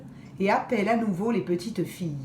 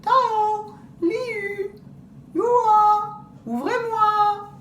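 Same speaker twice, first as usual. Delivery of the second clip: much higher, louder, drawn-out call; wolf calling as grandmother; 13 semitones higher, 6 dB louder, much slower than usual. Acted. Wolf imitating grandmother's voice to the girls, high call. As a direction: call sweetly through the door, faking granny. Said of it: "il crie".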